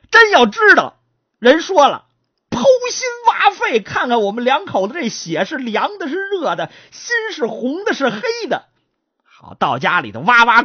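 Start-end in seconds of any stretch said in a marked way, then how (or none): 0.1-8.7 put-on high, wild voice of an outraged old lady; old mother vents her outrage; indignant old woman. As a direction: shriek the challenge at the couple. Old lady's imagined outburst.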